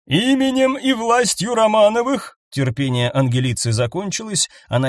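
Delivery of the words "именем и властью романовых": commanding noblewoman's decree; imperious command. A narrator's reading. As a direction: proclaim it with royal authority. Empress commands the servants.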